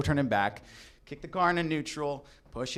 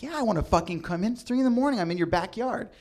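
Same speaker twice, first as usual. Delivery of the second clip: annoyed retort; 8 semitones higher, faster than usual. Retold or imitated. Annoyed at girlfriend.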